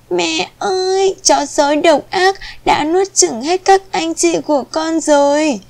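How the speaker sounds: put-on high-pitched wailing little kid goat; acted sobbing. A narrator's reading. Youngest kid tells mother the wolf ate everyone, acted sobbing. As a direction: cry out to mother in a high, distressed voice. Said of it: "dê út oá khóc nức nở".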